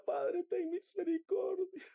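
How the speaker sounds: high, drawn-out plea; anguished retold cry for mercy. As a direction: implore the Father, slow and high. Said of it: "Yo le gritaba".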